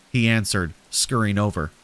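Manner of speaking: plain reading voice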